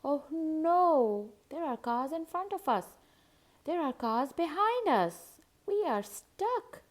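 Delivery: put-on a little girl panicking in the stuck car; high worried wail; dismayed child.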